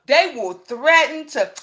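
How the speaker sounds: ordinary talk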